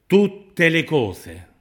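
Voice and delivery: loud, high, very slow "Tutte le cose"; emphatic proclamation. Real, not acted. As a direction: stretch "Tutte" and each word after it. Preacher hammers a repeated promise.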